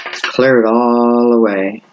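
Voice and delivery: slow, soothing meditation voice; slow calming guidance. Shown as a demonstration. Reader guiding viewers to clear their minds, slow and soothing. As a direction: ease them into a clear mind. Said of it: "go ahead and take a moment".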